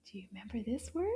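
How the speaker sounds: put-on hushed, secretive question to the kids; playful whispered quiz question.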